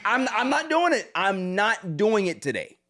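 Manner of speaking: loud, insistent refusal, starting high; exasperated refusal. Real, not acted. Host not doing it today, exasperated. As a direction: dig in loudly, pitch easing down on the repeat. Refuses to discuss losing team.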